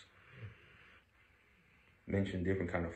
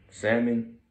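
loud, clear food words; 4 semitones higher, 6 dB louder, slower than usual. Real, not acted. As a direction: call out each word loudly. Testing if sleeping dog wakes. (llamando fuerte)

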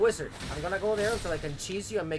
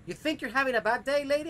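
higher, louder, faster outburst; angry rant; 9 semitones higher, 4 dB louder, faster than usual. Real, not acted. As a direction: let the rage boil over. Ranting at game character.

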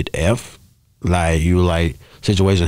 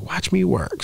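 mock high-pitched voice, a bit softer; imitating Erica; 12 semitones higher, 5 dB quieter, slower than usual. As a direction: toss it off lightly in a much higher voice, mimicking her. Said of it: "She's like".

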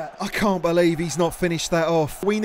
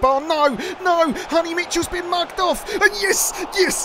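excited reaction; 9 semitones higher than usual. Real, not acted. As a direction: react to every kick live. Live reaction to near miss.

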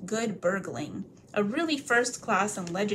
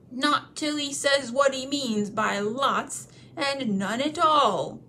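slow, rough troll voice; stubborn troll; 5 semitones higher, 4 dB louder, slower than usual. Acted. Bert refusing to let the hobbit go, slow and stubborn. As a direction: insist stubbornly.

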